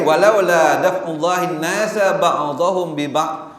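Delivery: slow, high chanted Arabic; Quranic recitation. Acted. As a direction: intone it like a recitation.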